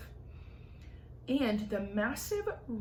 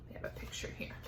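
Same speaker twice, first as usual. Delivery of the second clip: ordinary delivery, nothing marked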